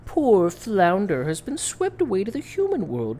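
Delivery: theatrical, swooping villainess voice; scheming sea witch. Acted. Sea witch tempting the princess to help her friend, mock-sweet. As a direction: coax with exaggerated rises and falls.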